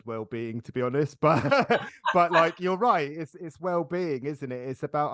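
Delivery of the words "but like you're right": laughing, higher and louder. Real, not acted. Host laughing and agreeing with her guest, louder. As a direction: laugh through your agreement.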